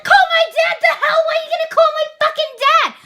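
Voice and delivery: high, loud, shocked yelp at the idea of calling dad; squeaky, disbelieving outburst, fast by the end; outraged disbelief. Pretend. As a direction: blurt it out in stunned outrage. But of course I don't say that.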